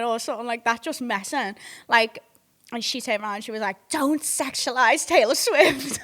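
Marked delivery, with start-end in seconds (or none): 3.9-6.0 loud, slow imitated telling-off; mock scolding. Superfan rebuking man at blackjack table, mock scolding. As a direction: draw it out as an outraged fan, higher and louder.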